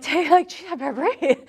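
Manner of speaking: lightly mock someone who suddenly remembers to breathe; startled student. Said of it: "they're like".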